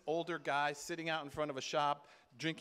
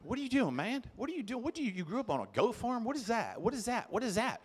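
higher, fast-talking imitation of Wes; a lightly higher impression of a fast talker; imitating Wes; 5 semitones higher than usual. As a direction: rattle it off like Wes. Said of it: "Wes talks like this".

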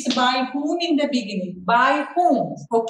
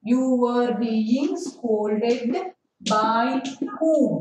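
very slow, word by word; very slow dictation; 2 semitones lower, much slower than usual. Shown as a demonstration. Teacher dictating the easy sentence to children. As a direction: stretch every word for writing. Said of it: "simple man way first".

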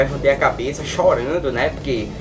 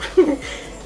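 high breathless burst of laughter; hysterical laugh; 13 semitones higher than usual. Retold or imitated. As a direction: break into helpless giggling. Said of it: "eu olhei pra professora e comecei a rir".

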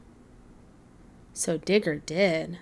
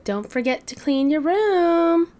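calling out; 8 semitones higher, 4 dB louder than usual. Acted. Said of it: "Mamasaur called".

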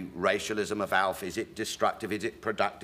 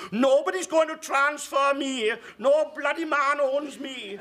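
much higher, slow, indignant woman's voice; 6 dB louder, slower than usual. Retold or imitated. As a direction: stand up for herself, outraged. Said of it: "some woman leapt up and said".

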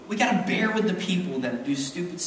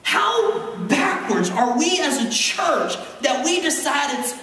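raised, building preacher voice; preaching; 10 semitones higher than usual. Real, not acted. Pastor pressing the church on forgiveness, fervent preaching. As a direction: preach it to them with rising fire.

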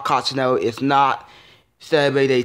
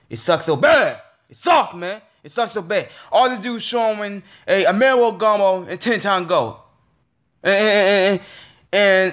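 angry rant; 5 semitones higher than usual. Real, not acted. Fan ranting at Cartoon Network, angry. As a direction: let the frustration boil over.

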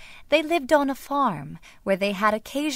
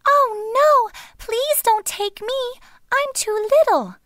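very high, pleading little goat; wee, quivering voice; 5 dB louder than usual. A narrator's reading. Tiny goat begging the troll, wee quivering plea. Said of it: "in a wee, quivering voice".

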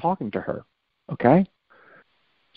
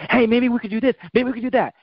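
mock eager problem solver; 11 semitones higher, faster than usual. Voicing an overthinking problem solver.

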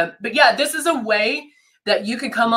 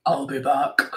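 low mock action-hero voice; movie tough-guy line; 6 semitones lower, 5 dB quieter than usual. Host showing off her deep sick voice, mock low action hero. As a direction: go deep and deadpan, playfully imitating. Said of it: "That sounded like".

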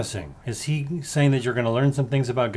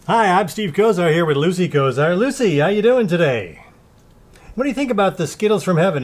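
raised, higher-pitched playful host voice; mock interviewer; 4 semitones higher, 4 dB louder than usual. Host interviewing Lucy the pet about the show, mock interviewer. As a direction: pretend to interview your pet on air.

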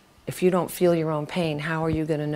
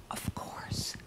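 very soft whisper; whispered realization; 18 dB quieter than usual. Retold or imitated. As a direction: whisper it as the penny drops. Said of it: "my mother lighting up and saying".

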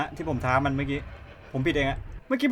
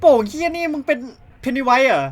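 startled angry yell; 9 semitones higher, 10 dB louder, slower than usual. Real, not acted. Balloon floating at him.

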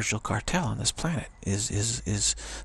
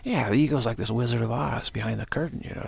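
ordinary talk

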